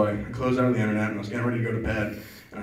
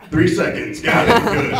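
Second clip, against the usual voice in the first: mock hasty timer; 5 semitones higher, 7 dB louder, slower than usual.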